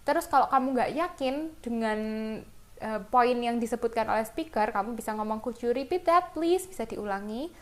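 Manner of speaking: ordinary talk, nothing marked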